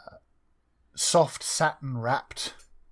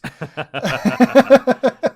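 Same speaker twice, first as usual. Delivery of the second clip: 1 laughing; 11 semitones higher, 13 dB louder than usual. Real, not acted. Player bursts out laughing.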